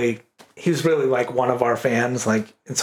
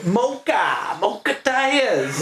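high, slow drawn-out Maine mumble; Maine slang; 8 semitones higher, much slower than usual. Retold or imitated. Quoting blueberry rakers in thick mock Maine accent. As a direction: stretch it out like a tired raker. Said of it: "catchphrases ... people I grew up with".

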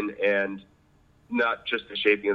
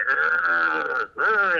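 long rolling grinder growl, higher and louder; imitated grinding; 7 semitones higher, 4 dB louder than usual. Mentor demanding bold cuts, mimicking a strong grind. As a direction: mimic a big confident grinding cut. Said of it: "I wanna hear".

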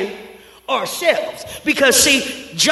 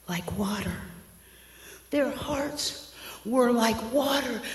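much softer, slow and heavy; hushed; 12 dB quieter than usual. Acted. Preacher telling the congregation of broken hearts, hushed and slow. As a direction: let the words sink like melting hearts.

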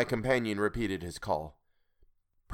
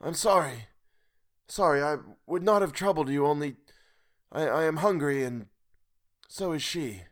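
high, halting, pitiful apology; hopeless and pathetic; 6 semitones higher than usual. A narrator's reading. Starving old man calling from afar, hopeless and pathetic. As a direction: falter pitifully and let it die away. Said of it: "sounding extremely hopeless and pathetic".

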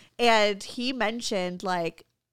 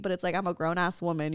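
ordinary talk

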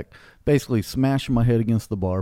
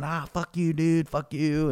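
higher-pitched imitation of a drunk heckler; mock outburst; 5 semitones higher, 3 dB quieter, slower than usual. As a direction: mimic a rowdy guy cursing, without extra volume. Retelling drunk man's outburst.

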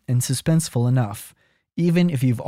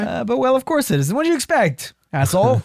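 high, mock German accent read; 8 semitones higher, faster than usual. Host rereading the review line in a German accent. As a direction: put on a bad German accent. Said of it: "I went to German".

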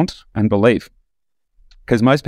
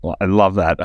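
ordinary talk, nothing marked

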